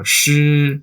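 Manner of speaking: shi held level and high in first tone. Shown as a demonstration. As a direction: sing out shi on one flat high pitch. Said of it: "practice that first tone on a ton of different syllables".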